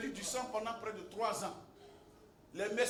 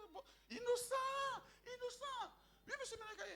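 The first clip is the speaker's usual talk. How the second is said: high-pitched mock cry of a flustered helper; a comic, squealing lackey; imitated high squeal. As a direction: squeak it out like a panicked assistant. Voicing a flustered assistant, crying out.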